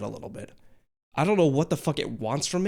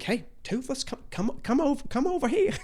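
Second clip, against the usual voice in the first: coaxing call; 12 semitones higher than usual. Retold or imitated. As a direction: lure Toothless over gently. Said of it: "I'd be like".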